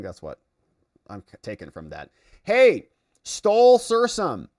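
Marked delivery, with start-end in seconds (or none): 2.4-4.5 drawn-out greeting. Real, not acted. Streamer hailing a returning donor, slow and loud. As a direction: call the name out long and loud, higher.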